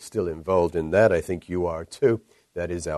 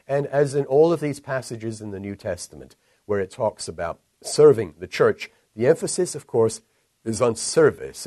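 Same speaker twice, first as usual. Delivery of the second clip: usual speaking voice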